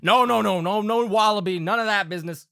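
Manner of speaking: insistent folksy country father; hearty Southern Pa. Acted. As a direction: wave off formality like a Southern dad. Game master plays Pa.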